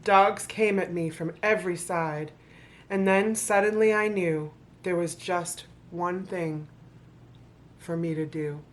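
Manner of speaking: rhythmic storybook reading to an audience; story narration. Acted. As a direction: read the rhymes like a bedtime book. Reads the picture book aloud.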